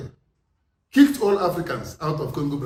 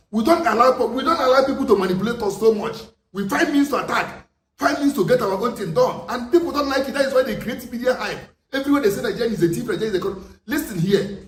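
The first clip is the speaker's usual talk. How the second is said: heated outburst; 6 semitones higher than usual. Real, not acted. Heated defence of Nigerians.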